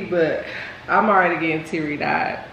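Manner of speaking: normal talking voice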